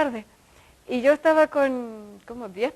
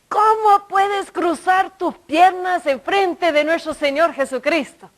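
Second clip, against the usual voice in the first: mock indignant scolding; 5 semitones higher, 3 dB louder than usual. Server scolding her for crossed legs, mock indignation. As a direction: draw out the outrage of an imitated scolder. Acting the older woman's scolding.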